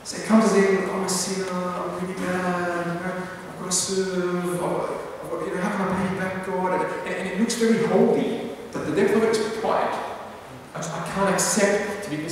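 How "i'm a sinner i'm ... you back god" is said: quietly grovelling, half-mocking piety; mock humble pleading. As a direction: play the guilty servant begging softly to repay God. Preacher acting out a humble inner voice.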